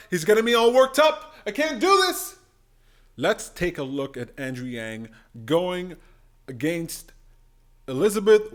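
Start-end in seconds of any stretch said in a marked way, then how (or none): 0.1-2.3 higher, louder, excited; real rush of excitement, voice climbing; worked up. Real, not acted. Vlogger hyped up by Yang's debate answers, loud and high. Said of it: "Jiang is getting me all worked up".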